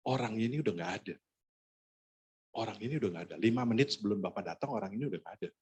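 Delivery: imitating someone quietly breaking bad news; discreet murmur. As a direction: murmur it discreetly to the person beside you. Man beside him discreetly reports death.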